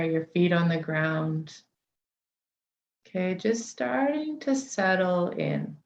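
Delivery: unhurried meditation guide, long pauses between phrases; guided meditation. Real, not acted. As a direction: settle listeners gently into the body, leaving space for each breath.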